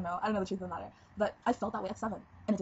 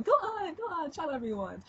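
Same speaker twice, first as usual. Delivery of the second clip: permissive future mom; 9 semitones higher, 4 dB louder than usual. Pretend. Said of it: "I'll be the one who be like".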